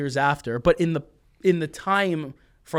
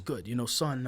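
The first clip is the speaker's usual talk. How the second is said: lightly voiced low, soft dad; a hint of a gentle father; dad's voice; 3 semitones lower, 8 dB quieter than usual. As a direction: son imitating his dad's soft voice. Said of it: "that's how he sounds".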